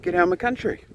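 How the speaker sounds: mock a nasty bigot. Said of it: "go up to people like me and say".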